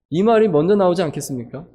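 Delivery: ordinary talk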